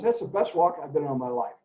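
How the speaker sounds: usual speaking voice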